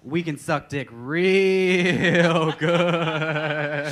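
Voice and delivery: very loud, long-held last words; bawdy loud boast. Pretend. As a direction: belt it out, dragging the end. Bawdy boast shouted for laughs.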